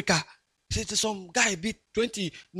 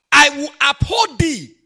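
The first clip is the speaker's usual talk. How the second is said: loud and slow, higher proclamation; forceful scripture; 7 semitones higher, 7 dB louder, slower than usual. Acted. Preacher quoting God's promise to uphold, loud and slow. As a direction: declaim the verse with full force.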